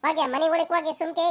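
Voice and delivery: ordinary talk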